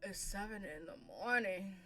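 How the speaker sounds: dry sarcastic remark. Acted. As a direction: say it deadpan sarcastic. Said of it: "I was being sarcastic".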